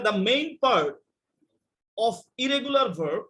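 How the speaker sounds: very slow, each word weighed; slow, deliberate stress on main; slow emphatic teaching. Shown as a demonstration. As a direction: spell it out slowly for the class. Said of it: "teacher slowly stressing key phrase".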